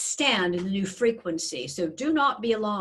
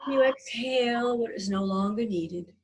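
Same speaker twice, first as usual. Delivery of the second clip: guided meditation. Acted. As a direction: gently guide their breathing. Leads a breathing meditation.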